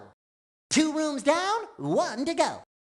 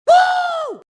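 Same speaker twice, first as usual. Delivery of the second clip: high, loud "woo"; excited whoop; 8 dB louder than usual. Acted. Sponge performing a song, loud high whoop. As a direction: let out a long whoop. Cheering on stage.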